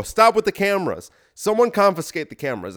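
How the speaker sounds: an annoyed leader yelling at the photographers; commanding shout. Pretend. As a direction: shout the room into stopping. Ordering photographers to stop.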